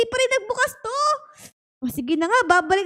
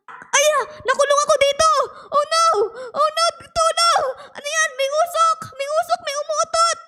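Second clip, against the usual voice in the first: playful panic; 6 semitones higher than usual. Real, not acted. Player trapped in the room yelling for help, panicky. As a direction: squeal like you're trapped and choking on smoke.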